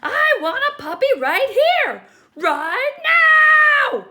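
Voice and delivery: high, very loud furious demand; tantrum shout. Acted. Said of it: "this pigeon's getting mad".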